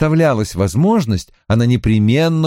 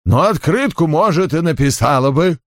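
higher-pitched, put-on voice of a deadpan secretary; grudging secretary; 6 semitones higher than usual. A narrator's reading. Secretary concedes she might write a card, grudgingly. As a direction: concede it grudgingly in a raised character voice.

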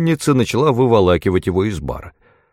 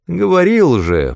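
higher-pitched mild grumble; grumbling; 9 semitones higher, 3 dB louder than usual. A narrator's reading. The cat grumbles at Merlin about the mages, mildly peeved. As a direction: grumble it as an I-told-you-so. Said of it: "проворчал кот".

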